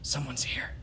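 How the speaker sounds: hushed, breathy warning whisper; old voice whispering a warning to the father; urgent whisper. Acted. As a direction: hush them, someone's at the door. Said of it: "the wizened old voice says".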